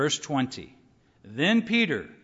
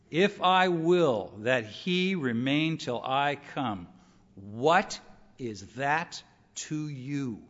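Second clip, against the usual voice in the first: weighty rebuke; 2 semitones lower, much slower than usual. Acted. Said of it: "be semi-angry at times".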